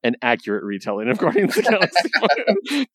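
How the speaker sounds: hammed-up announcer tone; mock narrator voice. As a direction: read it like a pompous caption. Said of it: "the editor thing says".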